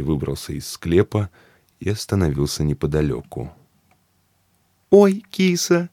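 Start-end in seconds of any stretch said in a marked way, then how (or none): 4.9-5.9 high, loud delighted cry; excited squeal. Acted. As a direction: squeal at the kitty. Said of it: "закричала вдруг Мария".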